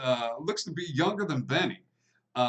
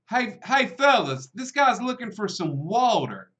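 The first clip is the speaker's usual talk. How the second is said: calling to the bar; 9 semitones higher, 5 dB louder than usual. Acted. Bartender calls out to bar.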